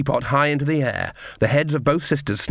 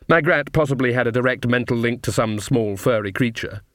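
plain reading voice

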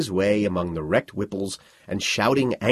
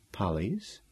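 puzzled; 9 dB quieter than usual. Acted.

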